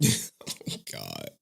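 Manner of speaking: breathy whisper through a laugh; whispered oh God. Real, not acted. Laughing to himself.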